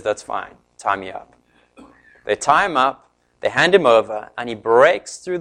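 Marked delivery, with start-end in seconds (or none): 0.0-1.3 low, soft and offhand; calm resignation. Retold or imitated. Samson agreeing to be bound, quietly offhand. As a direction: let them tie you, unbothered.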